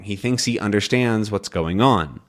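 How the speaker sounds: ordinary delivery, nothing marked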